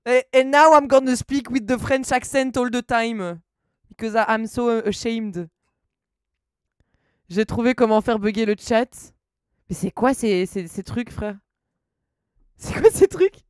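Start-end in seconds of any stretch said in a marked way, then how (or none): 0.1-5.5 English in an exaggerated French accent. Shown as a demonstration. Streamer teased by viewers about their English, hammed-up French accent. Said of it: "de mon accent en anglais". 12.6-13.5 high, loud incredulous outburst. Real, not acted. Streamer to chat about strange characters, incredulous.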